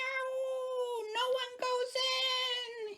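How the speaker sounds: high, slow monster bellow. Acted. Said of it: "he bellowed".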